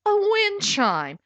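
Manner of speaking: irritated disbelief; annoyed. Acted. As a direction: scold her for the blunder. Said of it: "said Nigel with annoyance".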